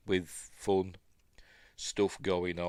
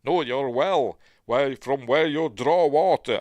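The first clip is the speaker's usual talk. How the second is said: louder, higher mock-Russian film quote; Russian accent; 6 semitones higher, 8 dB louder, slower than usual. Host quoting a film character, in a Russian accent. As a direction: put on a hammy Russian accent. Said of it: "I don't know why I'm doing it in, like, a Russian accent".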